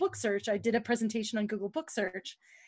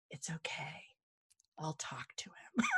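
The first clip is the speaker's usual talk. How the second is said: soft, hushed whisper, much lower; 8 semitones lower, 15 dB quieter than usual. Retold or imitated. Susan outside the room, whispering reassurance to the presenter. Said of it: "she took me outside".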